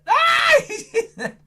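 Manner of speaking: high, loud startled yelp; mock fright. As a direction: jump out of your skin for the kids. Pretend fright at puppet.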